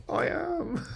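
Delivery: squeaky laugh through the words; self-mocking giggle. Real, not acted. Laughing at being oldest student.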